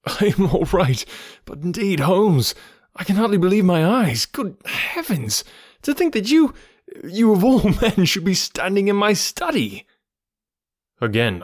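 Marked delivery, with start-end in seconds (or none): 0.1-9.9 high-pitched, overcome astonishment; amazed disbelief. A narrator's reading. Recovered man marvels at friend standing in his study, amazed. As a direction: exclaim as if you can't believe your eyes.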